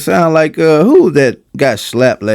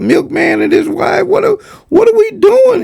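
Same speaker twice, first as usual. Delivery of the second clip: laughing. Real, not acted. Laughing disbelief at the clip.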